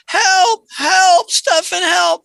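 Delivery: imitating a desperate man calling out; hammed-up panicked shout for help; retold cry for help. Customer calling from the bathroom.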